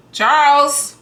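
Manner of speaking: loud call across the house. Real, not acted. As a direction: call him in from another room. Calling husband from another room.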